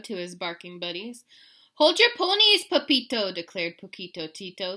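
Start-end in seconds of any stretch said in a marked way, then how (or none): 1.7-3.3 very high, loud little character; tiny dog. A narrator's reading. Smallest dog challenges Pepito, high loud voice. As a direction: squeak out a bold order. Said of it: "the smallest of the small ones".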